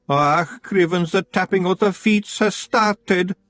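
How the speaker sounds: high, slow Scots moan; a wee man dreading the scolding. A narrator's reading. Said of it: "moaned Daft Woolly".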